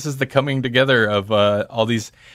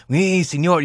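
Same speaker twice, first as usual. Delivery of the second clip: mock Mexican accent; 5 semitones higher than usual. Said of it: "the kind of offensive racist accent".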